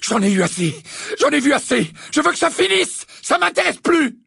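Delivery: high, loud, escalating outburst; furious shouting. A narrator's reading. Harry smashing the office in fury, shouting. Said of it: "leur cria Harry".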